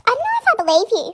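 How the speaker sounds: high, quick, lightly mocked teacher voice; doubting teacher. Teacher doubting a sick pupil, high mock scepticism.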